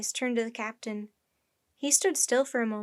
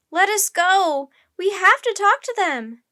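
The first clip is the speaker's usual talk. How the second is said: high, loud protest; yelling; 8 semitones higher, 13 dB louder than usual. A narrator's reading. Annie grabbed by sailors, kicking and yelling. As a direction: yell while struggling to get free. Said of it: "yelled Annie".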